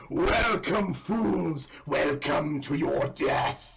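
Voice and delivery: slow, loud booming villain; dopey cartoon bellow. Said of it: "you hear a booming voice go".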